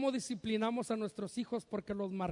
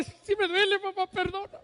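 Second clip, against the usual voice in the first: put-on high, pleading little boy's voice; a child breaking, begging forgiveness; child giving in; 7 semitones higher, 4 dB louder than usual. Son finally breaking down. (niño que se quiebra y pide perdón, con voz aguda)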